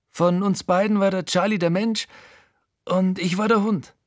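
höher verstellte Stimme, bitter und selbstverachtend; Frankie, bitter. Acted. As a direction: Frankie spricht hoch und bitter über sich selbst. (Frankie vergleicht sich mit seinem Hund, höher und bitter)

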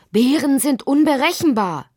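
high, loud alarmed warning; bellowed warning. A narrator's reading. Father warns Lotti about bears, shouting. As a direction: call out the danger urgently. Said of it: "brüllte Lottis Vater".

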